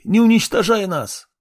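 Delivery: high, desperate plea; begging in fear. Acted. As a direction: beg for mercy. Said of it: "молились они".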